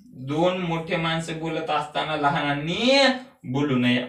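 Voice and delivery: usual speaking voice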